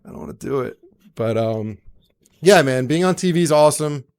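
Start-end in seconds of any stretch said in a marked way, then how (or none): 0.0-0.8 much lower, softer dismissive grumble; mock dismissive reply. Answering the braggers in a much lower, quieter voice. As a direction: drop the voice low and brush it off. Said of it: "I'm like".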